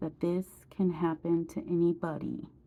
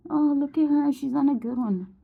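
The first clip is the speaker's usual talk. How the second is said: higher-pitched gossipy imitation; mocking judgmental onlookers; 7 semitones higher than usual. Imitating everybody who judges her looks, mocking higher voice. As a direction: mimic people passing judgement, pitched up.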